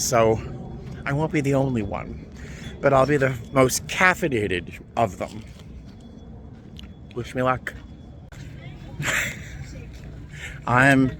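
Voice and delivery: ordinary talk, nothing marked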